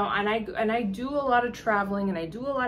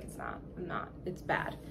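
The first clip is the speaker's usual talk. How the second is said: confiding whisper; 4 semitones lower, 11 dB quieter than usual. Retold or imitated. Vendor admits trouble.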